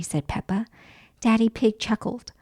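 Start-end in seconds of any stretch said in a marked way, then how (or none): none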